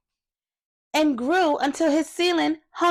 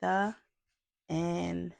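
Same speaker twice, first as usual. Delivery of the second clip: fading, much softer closing words; soft storybook ending; 5 semitones lower, 9 dB quieter than usual. Acted. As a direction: let the story end gently.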